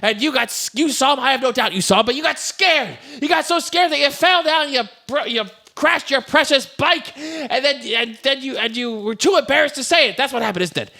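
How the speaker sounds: high, hammed-up accusation; pressing cross-examination in a high voice; mock accusing. Acts George exposing the biker.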